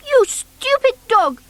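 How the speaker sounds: high, loud scolding; cross. A narrator's reading. Noddy scolds Bumpy Dog over the ruined garden, cross and loud. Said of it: "said Noddy crossly".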